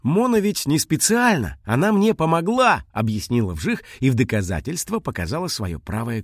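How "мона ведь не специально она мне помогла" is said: high, earnest defence; small creature explaining insistently; pleading little dragon. Acted.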